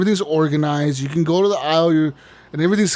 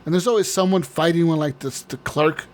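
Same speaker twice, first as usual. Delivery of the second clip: normal talking voice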